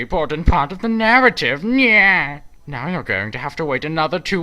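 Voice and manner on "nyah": loud high mocking nyah; taunting nyah. Villain taunts viewers over the cliffhanger, loud mocking nyah. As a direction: jeer at the audience like a cartoon villain.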